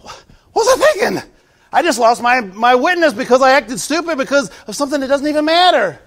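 high, loud mock regret; embarrassed self-talk. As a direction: scold yourself after a tantrum. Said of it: "Don't they say".

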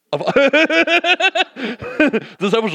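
real laugh bubbling through the words. Real, not acted. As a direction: crack up mid-sentence. Laughs at his own joke.

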